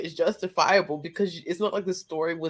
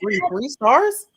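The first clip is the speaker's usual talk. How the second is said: slow, louder rising question; indignant double-take; incredulous; 5 semitones higher, 4 dB louder, much slower than usual. Real, not acted. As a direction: repeat it in stretched-out disbelief. Challenging the three-star rating.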